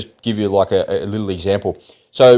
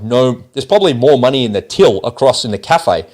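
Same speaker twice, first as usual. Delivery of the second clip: ordinary delivery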